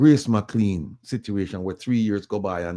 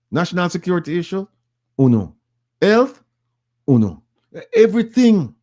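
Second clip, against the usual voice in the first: high, loud rallying list calls; rhetorical list; 7 semitones higher, 5 dB louder than usual. Real, not acted. Rallying Jamaican listeners, high loud list of who suffers. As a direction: hammer each item home.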